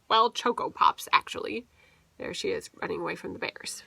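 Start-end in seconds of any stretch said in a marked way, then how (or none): 2.2-3.8 fast whispered aside. Real, not acted. Parent whispering about the picture to the child. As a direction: whisper it quickly.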